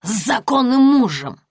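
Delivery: put-on furious drunk wife snarling back. A narrator's reading. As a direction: spit it out in a drunken rage. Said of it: "зарычала та".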